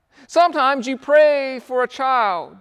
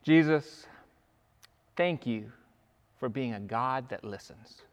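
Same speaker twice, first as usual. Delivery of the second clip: quiet prayer; 7 semitones lower, 5 dB quieter than usual. Acted. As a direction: pray gently to Jesus, voice lowered. Closing prayer.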